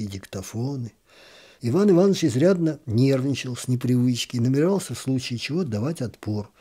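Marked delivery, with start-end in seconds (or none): none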